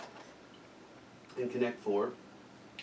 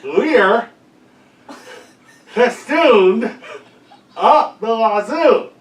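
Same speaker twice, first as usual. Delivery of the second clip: louder repeat; 9 semitones higher, 14 dB louder, much slower than usual. Real, not acted. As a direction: repeat it louder through a mouth guard, laughing.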